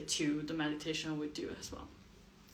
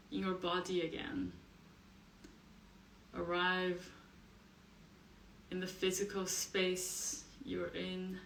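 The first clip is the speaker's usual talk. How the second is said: soft meditation voice with long pauses; guided meditation; 7 dB quieter than usual. Acted. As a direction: guide them quietly into the body, leaving space between phrases.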